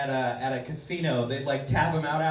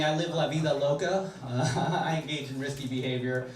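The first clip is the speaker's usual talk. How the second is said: normal talking voice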